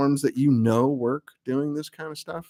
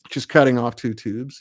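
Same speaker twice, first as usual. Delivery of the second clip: ordinary talk, nothing marked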